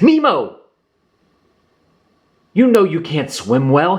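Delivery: a panicked father calling out his son's name; worried shout. A narrator's reading. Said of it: "he shouted".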